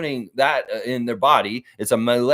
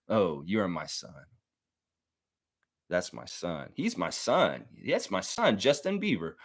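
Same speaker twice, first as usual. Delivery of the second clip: mock fond admission; 5 semitones lower, 7 dB quieter than usual. Fond turn toward his son.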